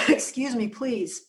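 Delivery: ordinary delivery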